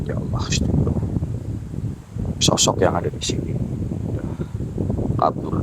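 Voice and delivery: low, hushed and tense; spooked whisper. Real, not acted. Ghost hunter whispering to viewers, spooked hush. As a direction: whisper so the spirit won't hear.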